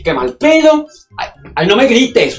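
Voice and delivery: shrieked high complaint; diva outrage. Acted. Diva at the stylist, loud outraged squeal. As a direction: squeal it in dismay.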